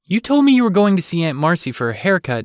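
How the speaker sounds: high, loud protest. A narrator's reading. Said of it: "she protested".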